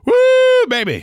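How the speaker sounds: a hollering Southern-church woo; excited whoop. Retold or imitated.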